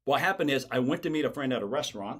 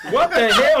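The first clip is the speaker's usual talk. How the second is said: high, loud, laughing disbelief; loud mock bafflement; 10 semitones higher, 12 dB louder, slower than usual. Baffled man on the phone with Stinson, loud and high. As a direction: exclaim it in exaggerated confusion. Acting out his confusion on call.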